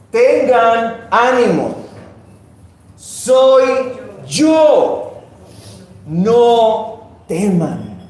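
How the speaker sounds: loud, slow, ringing proclamation; Jesus calling over the storm to his disciples; commanding call. Acted. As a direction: call out each phrase high and firm, with long pauses.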